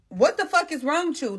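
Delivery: high-pitched angry yell at him; retelling a furious shout, pitched high; retold angry outburst. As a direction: snap at him for falling asleep driving. Retold yelling at sleepy driver.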